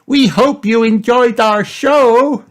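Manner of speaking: put-on high, loud child's voice, drawn out; slow, high, proud curtain call; excited little Peppa. A narrator's reading. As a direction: call it out to the audience in a piglet squeal. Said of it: "Peppa cried".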